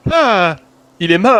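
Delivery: wide-eyed shocked cry; shocked gasp. Shown as a demonstration. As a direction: gasp it out in horror. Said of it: "tu fais".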